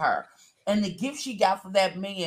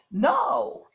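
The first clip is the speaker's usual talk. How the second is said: usual speaking voice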